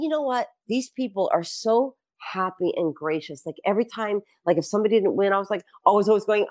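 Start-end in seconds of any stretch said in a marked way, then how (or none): none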